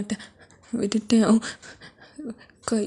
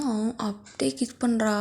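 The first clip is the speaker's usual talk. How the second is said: ordinary delivery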